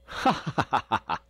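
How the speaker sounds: hearty high ha-ha laugh; roaring laugh. A narrator's reading. Ben roars with laughter at Jacob. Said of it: "roared Ben".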